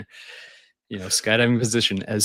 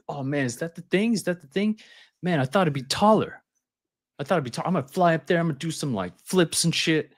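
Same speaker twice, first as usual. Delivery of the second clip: cocky customer; 6 semitones higher than usual. Retold or imitated. Imitating cocky customer Johnny.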